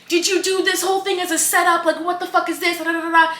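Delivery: high-pitched mock confrontation; angry accusation. Man accusing the host of a setup, mock outraged. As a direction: imitate an outraged man demanding answers.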